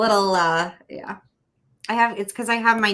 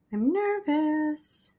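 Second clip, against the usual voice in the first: higher, very slow, drawn-out nervous whine; mock nervous whine; 9 semitones higher, 4 dB quieter, much slower than usual. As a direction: stretch it out like a jittery kid. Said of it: "And I'm nervous".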